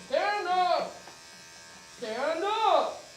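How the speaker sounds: loud barked command; drill sergeant voice. Shown as a demonstration. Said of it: "Everybody practice a drill sergeant voice".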